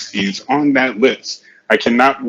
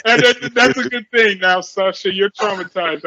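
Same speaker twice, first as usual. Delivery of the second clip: laughing; 9 semitones higher than usual. Real, not acted. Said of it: "I couldn't keep a straight face".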